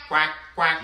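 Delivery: low quacking duck voice. Acted. Kids' class leader quacking for the children, low duck voice. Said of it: "Don't forget to quack".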